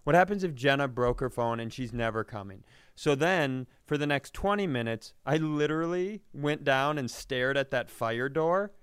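ordinary delivery

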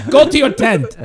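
high, loud stern mother. Retold or imitated. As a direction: order him off like a mother. Mother orders in comic bit.

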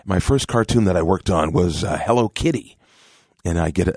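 normal talking voice

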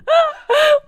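high squealing laughter; shrieking laugh. Real, not acted. Burst of laughter.

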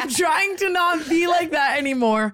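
exasperated protest. Real, not acted. Defending not airing relationship troubles to friends, exasperated shout. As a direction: shout it to cut through the teasing.

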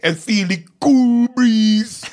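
high, slow, loud Jamaican accent; hammed-up Jamaican, stretched out. Shown as a demonstration. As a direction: swell into a drawn-out Jamaican flourish. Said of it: "when I was talking about".

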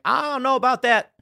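high, drawn doubtful retort; skeptical. Retold or imitated. Viewer answering the movie woman's hopeful line, skeptical. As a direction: push the pitch up in open doubt.

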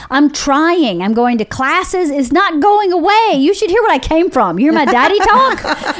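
frustrated student insisting it won't go away; exasperated younger self. Retold or imitated. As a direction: whine it like you've tried everything. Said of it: "And I kept going".

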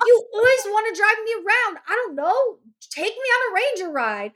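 high, exasperated snap; fed up, throw it back at him; irritated. Retold or imitated. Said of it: "So I'm irritated".

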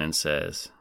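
ordinary talk

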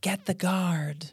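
a touch of slow eerie voice; ghost voice. Shown as a demonstration. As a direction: say it slowly like a distant ghost. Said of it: "Is it B".

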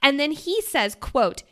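ordinary talk

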